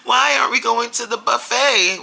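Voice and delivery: put-on a kid who feels cheated of her treat; whining child. Daughter protesting to mom driving home instead of buffet, loud high whine. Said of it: "she'd be like".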